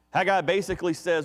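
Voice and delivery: usual speaking voice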